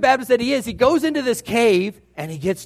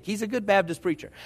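usual speaking voice

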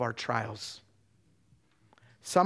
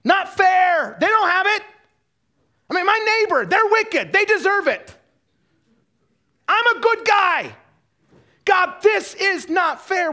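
put-on very high, loud child's protest to Dad; hammed-up shouting at the unfairness; contemptuous complaint; 13 dB louder, slower than usual. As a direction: yell it like an acted tantrum, stretching the last lines. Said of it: "It's like this contempt".

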